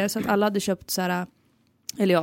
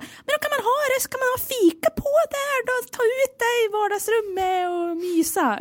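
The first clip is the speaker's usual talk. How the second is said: mom's voice; 13 semitones higher than usual. Retold or imitated. Daughter mimicking mom praising trays in a high cooing voice. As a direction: coo it like a mother selling cosy trays. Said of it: "Hon är så här".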